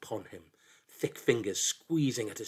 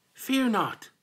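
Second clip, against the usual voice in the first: high, louder pleading little voice; desperate plea; 12 semitones higher, 5 dB louder than usual. A narrator's reading. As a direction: beg desperately. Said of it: "it pleaded, desperate".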